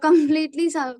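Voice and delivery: ordinary talk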